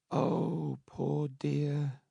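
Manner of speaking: put-on high, soft, pitying old nurse; an old nurse pities the dead mother softly. A narrator's reading. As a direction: coo it like a tipsy old nurse. Nurse voiced by narrator.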